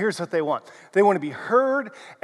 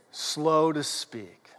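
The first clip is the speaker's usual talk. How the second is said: drawn out, softer, lower; slowed down; 5 semitones lower, 7 dB quieter, much slower than usual. Shown as a demonstration. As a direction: let the words crawl out slowly. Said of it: "would you repeat this after me".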